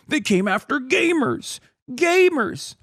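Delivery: high-pitched mock wail; mock crying. Streamer mocking men crying over gamer insults, mock crying. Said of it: "like, crying like this".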